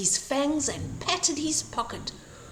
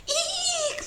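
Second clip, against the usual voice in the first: high squeaky mock scream; a startled little shriek; high startled squeak of fright. A narrator's reading. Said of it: "they screamed".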